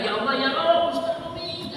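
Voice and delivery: high mock cry of alarm; retold cry for help. Javanese bystander at the crash crying out, high mock alarm. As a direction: call out like a shocked bystander. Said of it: "orang Jawanya berteriak".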